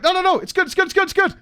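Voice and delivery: in his face. Retold or imitated. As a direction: get right in his face, won't take no. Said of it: "really in this guy's face about it".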